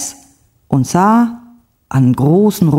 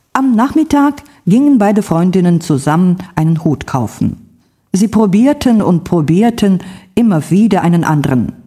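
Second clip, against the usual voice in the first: plain reading voice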